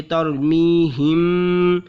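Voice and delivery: तरमीहिम with the मीम hummed through the nose; slow, nasal मीम in तरमीहिम; nasal ghunna. Shown as a demonstration. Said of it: "मीम में गुन्ना करना".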